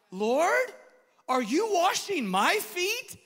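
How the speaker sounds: loud, high, drawn-out disbelief; Peter's shocked question. Acted. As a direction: stretch it out, scandalized and rising. Voices Peter's shocked question to Jesus.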